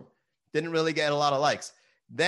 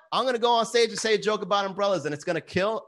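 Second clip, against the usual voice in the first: usual speaking voice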